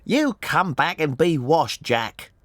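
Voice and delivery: high, scolding mother's call; shouted. A narrator's reading. Mother calls her little lad back to be washed, shouted. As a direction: call after a runaway child, pitched well up. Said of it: "she shouted".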